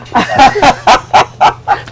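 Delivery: real burst of laughter; hearty laugh. Real, not acted. Host joking with her guest about her origins, laughing heartily. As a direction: laugh out loud at your own joke.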